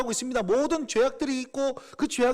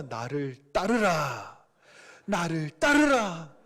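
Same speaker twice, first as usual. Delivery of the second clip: very slow, lower solemn command; voice of the Lord; 5 semitones lower, much slower than usual. Acted. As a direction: call them slowly with divine authority. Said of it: "이렇게 말씀하실 거예요". (낮고 느린 주님의 부르심)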